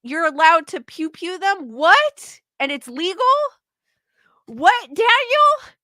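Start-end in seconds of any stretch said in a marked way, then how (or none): 1.7-3.2 loud, high rising what; can't believe what you read; disbelieving what. Real, not acted. Streamer reading Daniel's comment, loud disbelieving what. 4.5-5.8 much higher, slow, drawn-out what Daniel; stunned disbelief. Real, not acted. Streamer asking Daniel in disbelief, high and slow.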